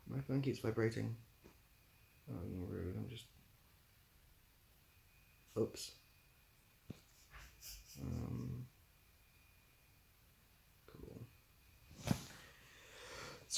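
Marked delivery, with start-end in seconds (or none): none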